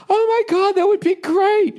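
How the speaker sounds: very high falsetto gushing; wife. Retold or imitated. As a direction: mimic a thrilled wife in falsetto, drawing out the end. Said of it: "my wife goes".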